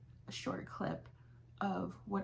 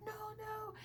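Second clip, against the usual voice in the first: high polite refusal; 8 dB quieter than usual. Retold or imitated.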